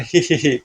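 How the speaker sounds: acted hee-hee giggle; silly, chuckled hee-hee; giggling. Havaldar greeting his boss, giggling. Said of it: "तुम ये हंस क्यों रहे हो".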